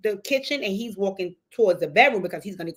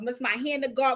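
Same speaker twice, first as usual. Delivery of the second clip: ordinary delivery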